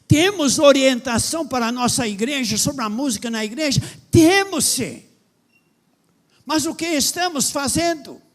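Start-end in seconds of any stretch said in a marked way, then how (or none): 4.1-5.0 emphatic yes. Real, not acted. Preacher answers his own question.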